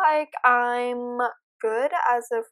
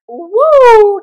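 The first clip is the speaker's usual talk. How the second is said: much louder, higher shocked whoop; startled loud whoop; 9 semitones higher, 18 dB louder than usual. Real, not acted. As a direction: yelp as it jumps into view. Animatronic appears suddenly.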